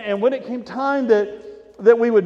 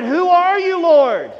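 loud, high cry to the Lord; 8 semitones higher, 7 dB louder, slower than usual. Retold or imitated. Saul on the road cries out to the Lord, loud. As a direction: call up in awe, full voice. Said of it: "I cried".

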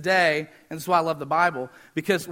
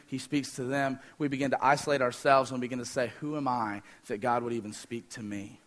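hushed preaching; 7 semitones lower, 8 dB quieter than usual. Acted.

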